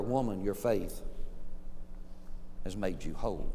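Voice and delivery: low, soft, unhurried words; gentle reassurance. Retold or imitated. Jesus to the woman he healed, gentle reassurance. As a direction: reassure her softly, letting it settle.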